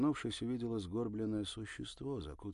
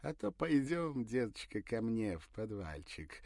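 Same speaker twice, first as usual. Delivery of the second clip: voice shaking with laughter; 5 semitones higher, 6 dB louder than usual. A narrator's reading. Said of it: "Голос задрожал от смеха".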